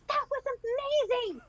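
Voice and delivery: high-pitched delighted exclamation; thrilled excitement. Acted. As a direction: burst out with pure excitement. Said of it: "Theron is so excited".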